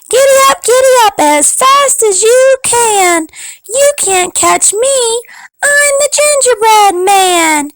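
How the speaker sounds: high, bold, teasing chant; high taunting cookie voice. Acted. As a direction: taunt them in a tiny high voice. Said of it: "and said".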